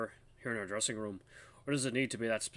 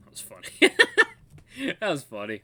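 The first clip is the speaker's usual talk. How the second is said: normal talking voice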